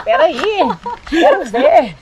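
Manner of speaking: high, loud, laughing demand; laughing insistence. Retold or imitated. Mother laughing as she demands to see her newborn. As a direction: laugh while insisting on seeing the baby.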